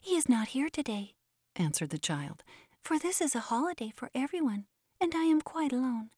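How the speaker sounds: put-on a small boy's high voice begging his father; high and eager, asking a favour; pleading little boy. A narrator's reading. Child answers the demon.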